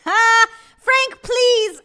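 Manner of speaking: high, loud 'please'; mock outraged shout. Real, not acted. Shouting at chatter's insult.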